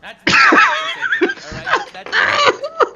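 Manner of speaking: cracking up, voice high and loud; words squeezed out between laughs; laughing through it. Real, not acted. Cracking up repeating his stance line to his co-host. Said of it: "Yo, I'm in tears".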